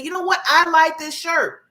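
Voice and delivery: high, emphatic declaration; confident. Acted. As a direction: proudly stand by it, stretching the words. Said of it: "You have to be confident enough to stand there and say".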